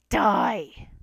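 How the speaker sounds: loud sinister shout; a flower yelling its death threat; villain's shout. A narrator's reading. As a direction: spit it at the trapped child. Flowey's final attack.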